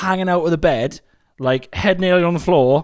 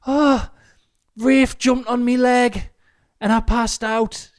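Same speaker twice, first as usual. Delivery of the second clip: high, slow, dazed and feeble; imitating Rosie; 11 semitones higher, 4 dB louder, much slower than usual. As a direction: moan it out weakly, very high. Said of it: "(answering as Rosie)".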